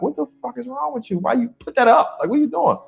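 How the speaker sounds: shocked protest. Retold or imitated. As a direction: throw it up high in shock. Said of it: "'cause I'm like".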